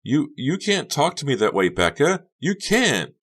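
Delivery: very high stammering protest; Honey wounded. Acted. Honey protests Becca's words, very high.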